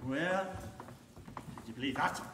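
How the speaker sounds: ordinary delivery, nothing marked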